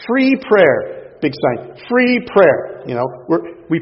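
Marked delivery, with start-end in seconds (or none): 0.1-1.2 high, loud call of the sign; loud barker call. Retold or imitated. Announcing the booth sign. 1.9-2.8 high, loud call of the sign; loud barker call. Retold or imitated. Announcing the booth sign.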